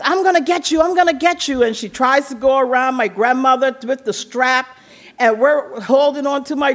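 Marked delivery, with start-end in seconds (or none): 0.0-1.6 raised mock-threatening mother; threatening mom. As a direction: lightly imitate mom going for the kids. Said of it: "And so she says".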